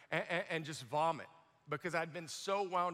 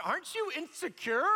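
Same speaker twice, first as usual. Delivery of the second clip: pitched-up, put-on voice, a touch of laughter; mock nervous question; 9 semitones higher, 3 dB louder than usual. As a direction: ask it like a nervous young man. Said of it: "I said".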